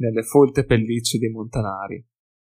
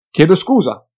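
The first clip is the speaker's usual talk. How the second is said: quick apology; 6 semitones higher, 9 dB louder than usual. Acted. Felix apologizes to bystander.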